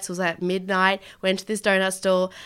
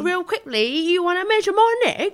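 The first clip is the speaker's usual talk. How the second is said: higher-pitched mock Leeds accent; 9 semitones higher than usual. Leeds customer answering the shop assistant, mock Leeds accent. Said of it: "do the accent".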